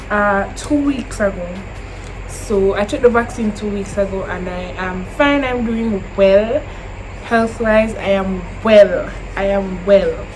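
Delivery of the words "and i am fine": usual speaking voice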